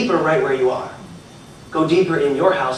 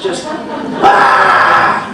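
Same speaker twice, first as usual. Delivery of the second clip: loud unvoiced burst; mock firework blast; 6 dB louder than usual. Speaker mimicking fireworks on the beach, loud burst. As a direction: bark a fireworks bang.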